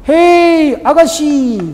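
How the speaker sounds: high, loud bird call; cheeky catcall. Acted. Parrot catcalling the woman in the street, loud and high. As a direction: hail her like a cheeky parrot.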